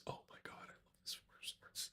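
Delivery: breathless excited whisper. Real, not acted. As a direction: whisper it, awestruck. Gushing over a card.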